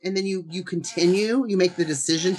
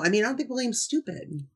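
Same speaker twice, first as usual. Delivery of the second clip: ordinary delivery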